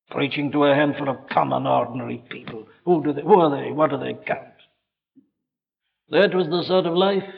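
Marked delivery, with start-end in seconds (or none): none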